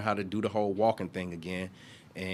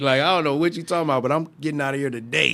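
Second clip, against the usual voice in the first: raised, laughing, fast and loud; loud laughing protest; 6 semitones higher, 10 dB louder than usual. Retold or imitated. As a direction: laugh it off loudly, pushing back. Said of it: "I'm like".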